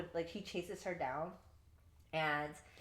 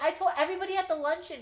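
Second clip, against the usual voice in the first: high, fast, bubbly chatter; mock ditzy Rue; 11 semitones higher, 7 dB louder, faster than usual. Rue proudly telling Gideon, high and fast. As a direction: rattle it off, proud and clueless.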